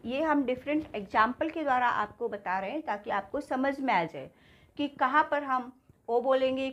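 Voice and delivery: ordinary talk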